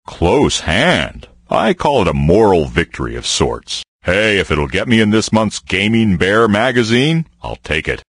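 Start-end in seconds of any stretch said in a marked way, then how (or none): none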